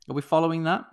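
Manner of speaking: usual speaking voice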